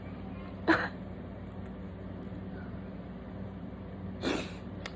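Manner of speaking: long, mostly voiceless sobbing; real crying. Real, not acted. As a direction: break into wordless weeping. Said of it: "have been of me crying".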